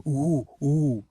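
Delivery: mock-dazzled cooing; excited toddler. Mocking Lip as a toddler, mock-dazzled cooing. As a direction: coo like a toddler spotting something shiny.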